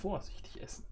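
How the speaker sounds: soft whispered caution; whispered warning. Acted. Host warns to eat carefully.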